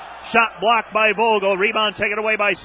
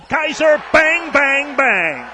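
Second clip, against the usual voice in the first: higher and louder, hammering each bang; excited shout; 6 semitones higher, 6 dB louder, slower than usual. Real, not acted. Announcer calling Kaiser's three, excited shout. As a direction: celebrate the three like a signature call.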